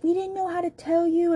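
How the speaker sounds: high-pitched mocking whine of an excuse; mock cheating husband. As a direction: parody his lame excuse in a squeaky voice. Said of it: "And he's like".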